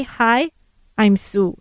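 ordinary delivery

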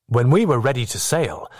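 usual speaking voice

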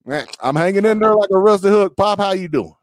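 high, hollered Southern greeting; thick Southern accent. Retold or imitated. On the phone with dad, exaggerated high Southern accent. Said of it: "I get on the phone with my dad".

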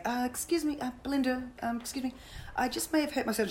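high, hesitant, apologetic voice; meekly trying to get the captain's attention; timid polite interruption. Retold or imitated. Keeper timidly telling the pointing captain she's hurt, high and hesitant. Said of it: "And I'm going".

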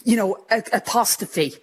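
ordinary delivery

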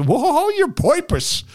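high, slow Three Stooges squeal; squeaky slapstick comedian voice; Curly impression. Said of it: "was it Curly with the Three Stooges".

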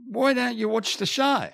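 put-on high, loud Spanish-accented innocent question; mock Spanish accent. Clueless friend questioning the famous footballer, in a high Spanish accent. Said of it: "he says in his beautiful way".

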